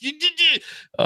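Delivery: high-pitched stammering splutter; mock flustered stutter, short of screaming; worked-up sputtering. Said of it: "I'm not screaming, but I'm just like".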